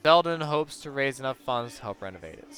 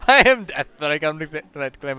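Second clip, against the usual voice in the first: dramatic Death; 6 dB louder, faster than usual. Acted. Character declares himself Death.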